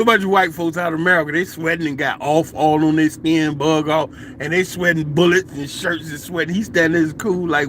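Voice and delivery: ordinary talk, nothing marked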